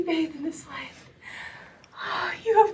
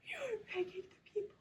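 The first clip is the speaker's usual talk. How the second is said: tearful whisper; 2 semitones higher, 18 dB quieter, faster than usual. Acted. Begging the watchers.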